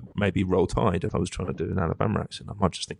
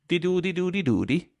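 much higher singsong dee-doo babble in a mock Dutch voice; Dutch accent warm-up noise; 9 semitones higher than usual. Actor recalling his audition warm-up, high mock Dutch babble. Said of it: "simply making the noise".

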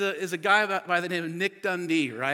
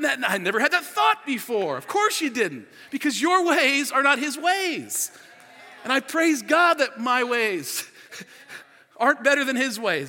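raised, louder preaching voice; impassioned preaching; 8 semitones higher, 6 dB louder than usual. Real, not acted. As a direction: preach it out with force. Preaches at full force.

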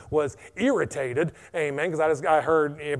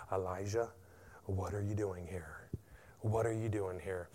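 softer, lower and gentle; soft voice of God; 5 semitones lower, 9 dB quieter than usual. Acted. The still small voice asking Elijah, softly. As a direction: ask it quietly, intimate.

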